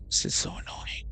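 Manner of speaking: whispered under the breath, fed up; whispered annoyance. Real, not acted. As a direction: hiss it quietly in frustration. Annoyed at game character.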